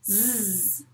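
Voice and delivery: "Z" held as a long zzz; son Z isolé. Shown as a demonstration. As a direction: model "Z" as one steady buzz to be repeated. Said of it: "après moi". (« Z » en bourdonnement tenu)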